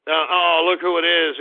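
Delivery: normal talking voice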